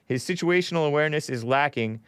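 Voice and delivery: ordinary delivery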